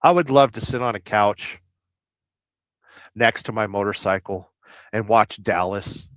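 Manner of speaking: ordinary talk, nothing marked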